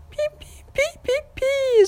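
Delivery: high slow squeaking; mouse squeak. A narrator's reading. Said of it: "запищал мышонок".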